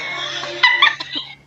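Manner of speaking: high squealing burst of laughter; shrieking laugh. Real, not acted. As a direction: let out a piercing squeal-laugh. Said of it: "I have the worst laugh".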